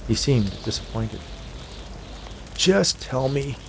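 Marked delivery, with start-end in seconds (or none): 2.5-3.5 slow, higher-pitched plea; pleading. A narrator's reading. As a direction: draw the words out, pleading. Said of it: "Hank pleaded".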